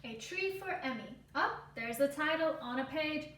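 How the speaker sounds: normal talking voice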